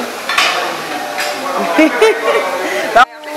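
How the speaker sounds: loud, drawn-out giggling fit; long laugh. Real, not acted. Laughs after repeating spaghetti.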